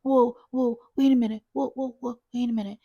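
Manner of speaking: high, loud burst of whoas; flustered coworker. Retold or imitated. Coworker reacting to an irate caller, flustered. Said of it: "sounded like she got hit by a tornado".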